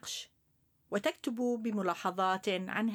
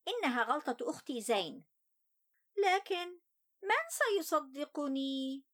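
put-on higher young boy's voice, sulky; child voice; 8 semitones higher than usual. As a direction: tell it as an upset little boy. First-person child narrator Ali.

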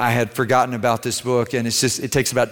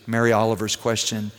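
ordinary talk

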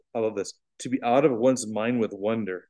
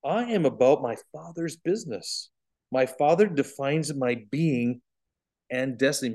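lightly voiced, higher, slow young Jesus; a hint of the boy Jesus, puzzled by their worry; gently surprised Jesus; 4 semitones higher, slower than usual. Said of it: "and said".

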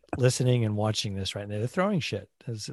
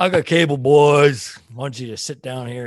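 put-on Southern accent; 2 semitones higher, 8 dB louder than usual. Sailor calling the boys to watch, Southern accent. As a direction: holler it to the boys in Southern, then settle. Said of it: "get into Southern accent".

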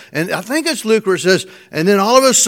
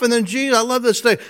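ordinary talk, nothing marked

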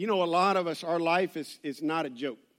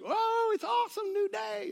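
high, chirpy sing-song wake-up voice; much higher, bubbly fake cheer; mock cheery morning person; 12 semitones higher, 4 dB quieter than usual. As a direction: mimic an annoyingly upbeat early riser. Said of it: "you wake up and you're like".

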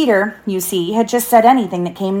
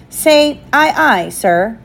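put-on higher, louder boyish command; pitched-up captain's order, falling at the end; 5 semitones higher, 4 dB louder, much slower than usual. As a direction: order him to repeat it. Peter orders John to repeat.

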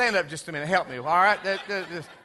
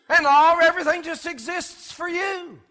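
ordinary talk, nothing marked

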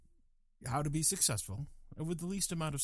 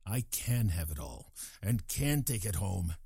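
lower, emphatic declaration; self-assertive mantra; 5 semitones lower than usual. Acted. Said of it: "repeat this mantra out loud".

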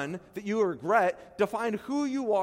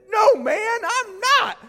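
high, loud, put-on voice shouting no; exaggerated denial; 9 dB louder, much slower than usual. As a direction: ham up a panicked denial. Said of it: "That's how I read that verse".

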